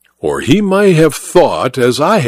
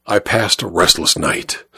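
usual speaking voice

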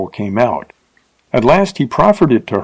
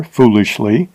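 ordinary delivery

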